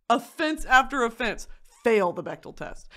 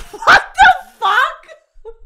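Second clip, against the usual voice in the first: loud, high, slow laughing shriek; shrieked, laughing incredulity; incredulous yell; 12 dB louder, much slower than usual. Real, not acted. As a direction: yell it in drawn-out disbelief. Outraged reaction to men's answers.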